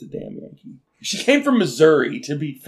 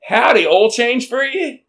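a hammed-up friendly Southern salesman; Southern salesman greeting; 10 semitones higher, 5 dB louder than usual. Selling oil changes to old ladies, in a mock Southern twang. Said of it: "I'd walk out and go".

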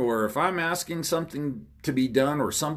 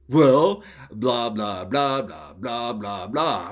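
loud "well," then lower droning blahs; mocking excuse-maker; 3 semitones lower, 3 dB louder, much slower than usual. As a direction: imitate someone's empty rambling excuse. Mimics the culprit's empty explanation.

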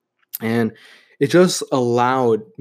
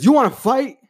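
sharply higher, louder shout of a furious father; father's angry challenge; 12 semitones higher, 5 dB louder than usual. Retold or imitated. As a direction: throw the challenge right in his face, much higher and louder. Angry father confronting son.

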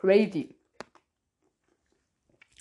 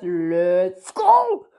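voice changer; 4 semitones lower, 5 dB louder than usual. Pretend. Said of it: "Hm, Stimmverzerrer".

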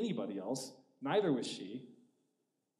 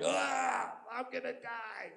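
mock despair; 7 semitones higher, much slower than usual. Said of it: "the first three years was like".

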